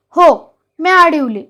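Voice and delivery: loud, slow, defiant shout; shouted defiance. A narrator's reading. Yetalya shouting back at the old mother, loud and slow. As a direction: bellow it out, biting each word. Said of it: "येताळ्या ओरडला".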